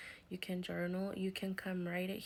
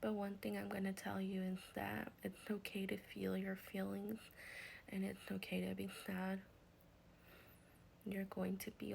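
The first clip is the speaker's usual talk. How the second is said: guided healing meditation; 6 dB quieter than usual. Acted. Healer guides grieving viewers to release anger, soft meditation voice. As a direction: ease them through the release, gently and softly.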